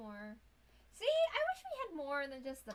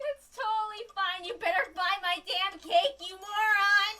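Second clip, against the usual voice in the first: very loud, high, manic outburst with shrieking laughter; hysterical shouting; 11 semitones higher, 17 dB louder than usual. Real, not acted. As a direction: yell as if cracking up over a game. Hysterical reaction to lives, customer.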